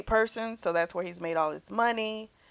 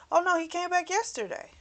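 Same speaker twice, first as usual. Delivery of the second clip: chirpy assistant; 11 semitones higher, faster than usual. Retold or imitated. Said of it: "the woman says".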